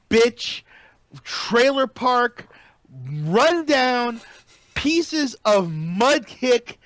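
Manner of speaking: slow, punched-out words with pauses; scornful rant. Real, not acted. Podcaster ranting about the heckling fans, slow emphatic scorn.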